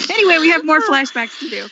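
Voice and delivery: ordinary talk, nothing marked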